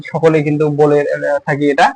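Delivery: normal talking voice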